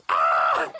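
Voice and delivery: low, loud startled cry; startled shriek. Acted. Ladybug recoiling from the gut-covered bug with a scream. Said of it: "she went".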